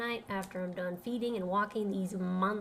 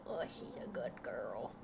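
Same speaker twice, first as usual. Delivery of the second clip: soft whispered praise for the dog; hushed good-girl whisper; 13 semitones lower, 10 dB quieter than usual. Acted. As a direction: breathe it to the dog.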